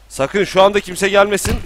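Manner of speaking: loud, high panicked plea; panicked shout. Real, not acted. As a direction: yell in sudden panic. Panik anında bağırıyor. (panikle bağırış)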